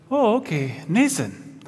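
mock Danish teacher, "Nathan" said with an s for th; badly faked Danish accent, saying "Nathan" as NAY-sun. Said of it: "that was not a good Denmark accent".

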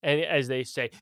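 usual speaking voice

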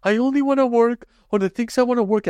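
high, whiny mock of his own attitude; mock himself in a high whine; mock whiny self. Mocking his own attitude to work, high whine. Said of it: "someone like me who's like".

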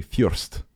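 "Fürst" with a y glide after the F; teacher's Ukrainian pronunciation. Retold or imitated. Quoting his math teacher, "Fürst" said "fyoorst". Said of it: "he would use the word first. He would say".

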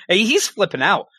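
ordinary talk

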